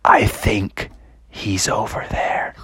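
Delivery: slow, hushed whisper; stealthy, breath-only voice; secretive whisper. Acted. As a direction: whisper as if hiding from someone nearby. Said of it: "they, they said".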